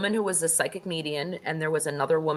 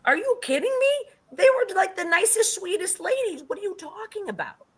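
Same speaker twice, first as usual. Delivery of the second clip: much higher, outraged disbelief; 13 semitones higher than usual. Retold or imitated. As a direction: squeak it out, can't believe her ears. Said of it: "And I was like".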